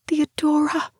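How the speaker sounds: high-pitched, fearful plea by name; frightened call. A narrator's reading. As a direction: call to your companion in high-pitched fear. Frightened call to unseen companion.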